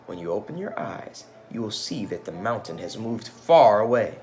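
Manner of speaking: put-on storytelling voice for children; gentle read-aloud storyteller; story narration. A narrator's reading. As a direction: read it like a picture book aloud.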